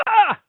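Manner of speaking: high, loud mock gasp of horror; shocked gasp. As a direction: gasp as if you saw something terrible. Clients shocked at seeing face.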